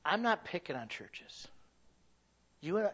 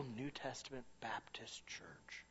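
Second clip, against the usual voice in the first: whisper; 5 semitones lower, 17 dB quieter, slower than usual. Acted. Sermon point dropped to whisper.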